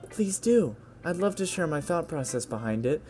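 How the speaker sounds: put-on high, gentle girl voice; Yuri. Shy girl invites friend to read, high gentle voice. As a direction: play a shy girl eager to share, high.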